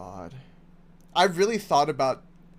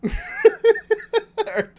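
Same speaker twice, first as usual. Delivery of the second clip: high cackling laugh; loud burst of laughter; 8 dB louder than usual. Real, not acted. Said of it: "laughs after nerd voice".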